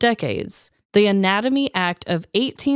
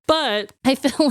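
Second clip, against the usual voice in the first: ordinary talk, nothing marked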